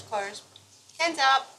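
talking through a mouthful of chocolate; chewing while speaking; mouth full of chocolate. Real, not acted. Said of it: "my mouth's full of chocolate".

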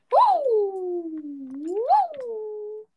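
high, loud cheering; excited whoops. Real, not acted. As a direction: whoop with joy. Cheering in game.

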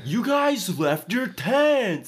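loud, high, drawn-out comic outrage; hammed-up high shouting; mock furious yell. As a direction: yell like an indignant camp counselor, hammed up. Imitates angry counselor chasing campers.

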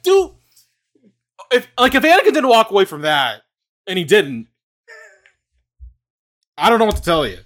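loud, high disbelieving rant; exasperated outburst. Retold or imitated. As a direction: blurt it out, fed up with Anakin. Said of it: "I'm like".

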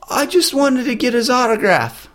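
mock tearful high-pitched plea; whiny fan. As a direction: mimic the sobbing fan's excuse. Girl's tearful answer retold.

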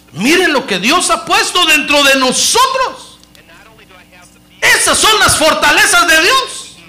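loud, high, slow preaching; fiery slow preaching; preaching at full force. Acted. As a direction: proclaim it to the whole church.

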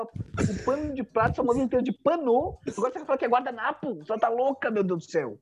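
high mock indignant mother; imitating mom. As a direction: play the exasperated mom. Retelling mother's indignant reply.

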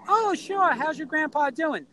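high, swooping acted greeting; delighted recognition. News anchor recognising a friend's grandson, acted delighted surprise. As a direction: imitate someone pleased to place the family name.

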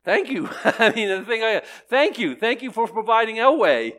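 high, loud, grateful thank you; joyful, loud gratitude. Real, not acted. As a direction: shout your thanks up high. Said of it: "loud grateful thanks".

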